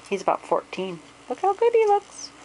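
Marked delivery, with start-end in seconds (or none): 1.3-2.3 squeaky, delighted and louder; high pet voice. Real, not acted. Owner shows off her fourteen-year-old cat in a high gushing voice.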